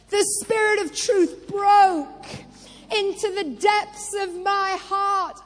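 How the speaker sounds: loud rising shout on broke; preacher's shout. Acted. Preacher telling the church his breakthrough, loud shout. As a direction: belt it out, hitting broke hard. Shouted climax in sermon testimony.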